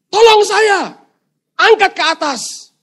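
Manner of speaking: high, loud order for help; shouted demand. Acted. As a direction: demand to be hauled up right now. Stranded man demands rescue.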